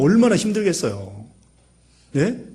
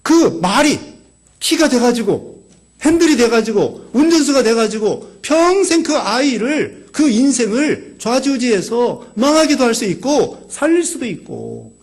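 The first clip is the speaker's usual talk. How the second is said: fervent preaching; 7 semitones higher, 4 dB louder than usual. Real, not acted. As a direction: preach it out at full force to the congregation. Preaching at full force.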